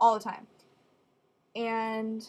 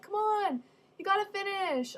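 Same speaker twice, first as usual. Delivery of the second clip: higher, mock pushy mom; urging mom; 9 semitones higher than usual. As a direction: egg her on like a jogging mom. Mom urging while running.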